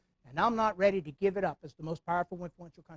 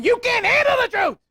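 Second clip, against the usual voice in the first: high, loud shouted courtroom outburst; Jack Nicholson; 13 semitones higher, 8 dB louder than usual. Retold or imitated. Nicholson yelling from the witness stand, loud high shout. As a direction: bellow it like the movie witness stand. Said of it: "everybody remembers Jack Nicholson sitting on the stand".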